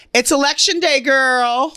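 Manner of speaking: high, loud, hyped ad-read voice; promo read. Acted.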